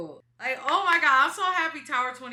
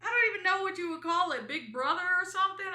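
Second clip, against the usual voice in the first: excited; 12 semitones higher, 6 dB louder than usual. Real, not acted.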